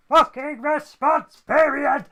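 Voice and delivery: loud, high outburst, each word hammered; exasperated rant. Real, not acted. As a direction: shout it out word by word in frustration. Mock rant about complaints procedure.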